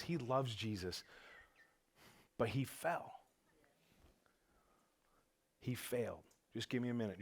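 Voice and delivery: preacher pausing, moved, speaking quietly to Joey; quiet, emotional. Real, not acted. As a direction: hold back the emotion. Emotional pause.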